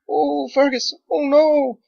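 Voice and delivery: wife cries out for her husband; terrified cry. Acted.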